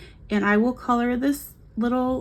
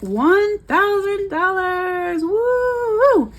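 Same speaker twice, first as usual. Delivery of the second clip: high, slow, drawn-out announcement, then a whoop; triumphant cheer; 10 semitones higher, much slower than usual. Real, not acted. Celebrating a thousand dollars paid off, high triumphant whoop.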